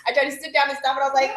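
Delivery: ordinary talk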